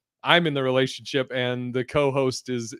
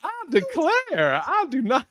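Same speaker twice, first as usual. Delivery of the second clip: high, slow mock Southern belle; 11 semitones higher than usual. Host teases the guest's accent with a slow mock Southern belle. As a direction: tease him with a prissy Southern drawl.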